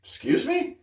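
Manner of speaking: very high, incredulous question; retold disbelief, pitch shooting way up; acted bafflement. Shopper answering a strange man, high-pitched acted bafflement. Acting out his baffled reaction.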